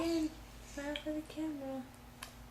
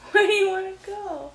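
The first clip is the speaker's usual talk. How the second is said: louder question broken by laughter; laughing; 4 semitones higher, 13 dB louder than usual. Real, not acted. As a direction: laugh through the words. Parent laughing with baby.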